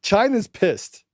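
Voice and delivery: high, slow put-on Chinese accent; mock Chinese accent. Joking about China, in a hammed-up Chinese accent. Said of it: "Did I do that wrong?".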